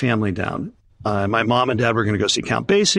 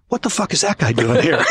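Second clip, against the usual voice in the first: imitating an alarmed accountant, raised pitch; mock outrage, pitched up; retold indignant demand; 5 semitones higher than usual. Tour accountant confronts promoter about his helper, imitated indignation.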